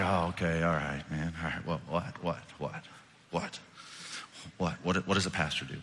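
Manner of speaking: low, subdued, repeating reluctantly; resigned giving in. Retold or imitated. Pastor reluctantly calling on the kid, resigned. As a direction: give in wearily, voice dropped low.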